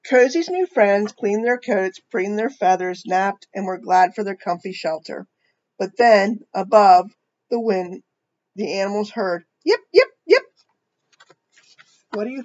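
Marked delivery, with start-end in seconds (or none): 0.0-9.5 put-on reading a picture book to children; storybook reading voice; story narration. A narrator's reading. 9.6-10.4 high, excited husky yipping; high playful yips of an approaching dog team. Acted. Huskies heard yipping.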